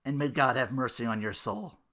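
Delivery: ordinary talk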